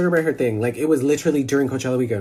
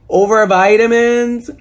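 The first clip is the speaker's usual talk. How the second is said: loud disbelief; 8 semitones higher, 11 dB louder than usual. Real, not acted. Laughing disbelief at the vitamin drama.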